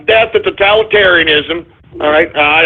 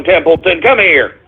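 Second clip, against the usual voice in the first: ordinary delivery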